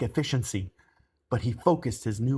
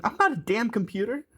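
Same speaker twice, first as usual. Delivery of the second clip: high, indignant protest; flustered and incredulous; flustered stammerer; 10 semitones higher than usual. A narrator's reading. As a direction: protest in a rising, exasperated pitch. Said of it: "Jazz stammered".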